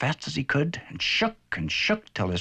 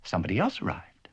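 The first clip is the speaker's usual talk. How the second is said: ordinary delivery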